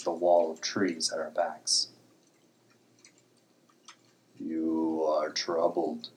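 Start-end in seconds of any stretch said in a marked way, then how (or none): 4.4-6.1 slow rumbling rock creature; throaty grinding voice. Acted. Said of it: "His throaty, grinding voice".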